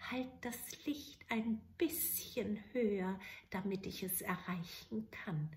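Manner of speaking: put-on whispered, sly old witch. Witch slyly reaching for the blue light, whispered. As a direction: hush it, hiding the trick.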